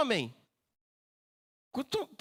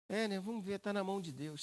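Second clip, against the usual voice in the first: soft, faithless muttered reply; resigned lament; 7 dB quieter than usual. Acted. Someone without faith answering a promise of healing, soft resigned lament. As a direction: toss the words away without conviction. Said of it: "até de lamento".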